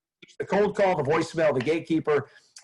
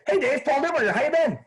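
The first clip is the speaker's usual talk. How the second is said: put-on high cheery hello like an old friend; friendly rising "how you been"; upbeat familiar greeting; 11 semitones higher than usual. Shown as a demonstration. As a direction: sound glad to reach him. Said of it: "and then I say".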